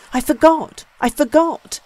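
high, loud, despairing repetition; disconsolate. A narrator's reading. Young man grieves over the lost money, high and loud. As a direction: cry it out in sudden distress. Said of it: "he repeated disconsolately".

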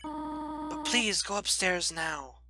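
voicing Toriel. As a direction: Toriel gently begging the child to go upstairs. Reads Toriel's lines aloud.

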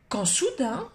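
plain reading voice

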